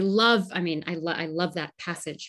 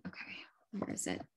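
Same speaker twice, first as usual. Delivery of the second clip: whispering to herself; 17 dB quieter, faster than usual. Real, not acted. Searching for the page aloud.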